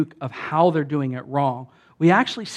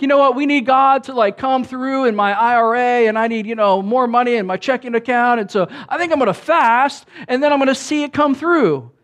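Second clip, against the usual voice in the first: higher, louder mock of a demanding believer; pushy bargainer; 8 semitones higher, 5 dB louder than usual. Believer scheming to get God to come through, pushy. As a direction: rattle off demands at high pitch and volume. Voicing those who fast to manipulate.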